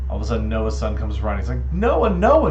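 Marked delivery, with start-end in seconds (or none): 1.7-2.5 very loud, high shout for Noah; alarmed son. Acted. Son runs to Noah about monkeys, urgent shout. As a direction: yell for your father urgently.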